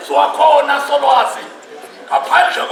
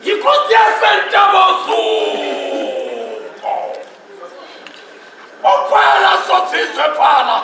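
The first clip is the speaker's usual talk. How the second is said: praise shouting; 3 semitones higher than usual. Acted. Praise poet hails Mqhayi.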